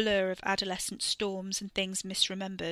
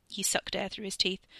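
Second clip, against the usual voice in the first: ordinary delivery, nothing marked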